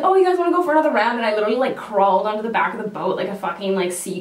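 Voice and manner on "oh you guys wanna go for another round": taunting driver. Retold or imitated. Driver taunting after the crash.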